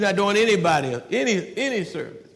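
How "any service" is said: ordinary talk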